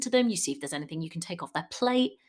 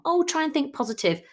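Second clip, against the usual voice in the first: "Oh, try and think positive" higher, louder and quick, mock-bright; chirpy platitude; 8 semitones higher, 4 dB louder, faster than usual. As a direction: toss off "think positive" like a breezy cliché. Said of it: "That's one that I hear a lot".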